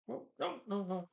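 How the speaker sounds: a startled, high-pitched "oh no"; sudden alarm. Real, not acted. Realizing the wrong thing got deleted, startled. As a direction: caught off guard by a slip.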